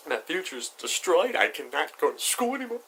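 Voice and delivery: high-pitched, hammed-up despair; mock panic. Teen fearing arrest at sixteen, high mock panic.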